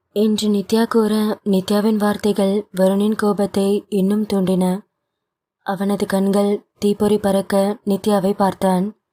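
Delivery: plain reading voice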